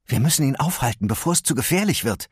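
quick urgent warning; urgent Gwen. Acted. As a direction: push the team to hurry. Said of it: "sagte Gwen".

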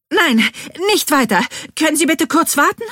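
high, loud, shrill protest. A narrator's reading. Bean stopping the workman mid-assembly, shrill. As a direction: cut in shrilly to halt it. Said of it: "fügt sie schrill hinzu".

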